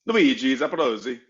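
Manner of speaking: put-on Luigi Zaprosi in a light Italian accent. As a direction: say the name like an Italian. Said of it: "Can you say that with an Italian accent".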